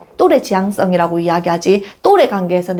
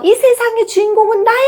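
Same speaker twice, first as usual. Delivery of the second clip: much higher proud shout; child shouting; 12 semitones higher than usual. Retold or imitated. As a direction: proclaim it from a mountaintop. 아이가 산 꼭대기에서 외침. (산꼭대기에서 외치는 아이, 훨씬 높게)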